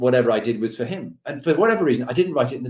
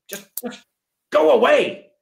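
mock dismissal, much higher and louder; an exasperated boss shooing him off, played big; retold boss pushing "go away" out high and loud; 11 semitones higher, 5 dB louder, slower than usual. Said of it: "It wasn't hard".

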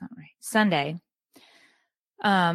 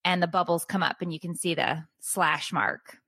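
normal talking voice

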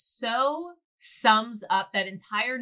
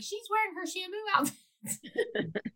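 high, slow, mimicking voice; parroting her in a lifted voice; imitating her friend; 10 semitones higher, 5 dB quieter, slower than usual. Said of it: "She's like".

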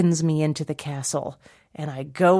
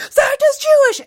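put-on very high, loud kid's shout; loudest repeat; 8 dB louder than usual. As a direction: shout the news at the top of a child's voice. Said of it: "louder and louder and louder".

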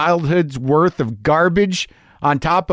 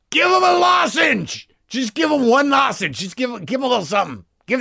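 much higher yelled demand; higher, shouted craving voice; 7 semitones higher than usual. Acted. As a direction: bark the order for the lozenge. Inner voice demanding lozenge.

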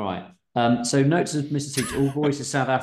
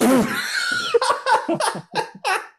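squealing laugh, high; high burst of laughter; 4 dB louder than usual. Real, not acted. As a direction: break up laughing. Laughing at own reading.

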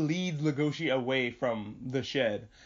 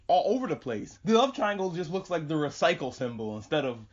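ordinary talk, nothing marked

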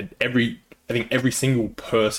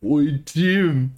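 put-on, higher call to a mate; imitating pestering friend; 4 semitones higher than usual. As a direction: get a studying friend's attention, put-on voice. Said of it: "He's like".

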